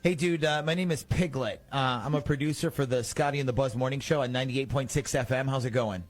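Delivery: usual speaking voice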